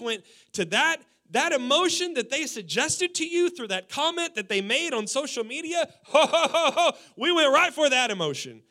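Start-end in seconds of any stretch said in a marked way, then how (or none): 6.0-8.6 loud, high chuckling laugh. Real, not acted. Pastor laughing at the congregation's petty revenge. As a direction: laugh it out mockingly.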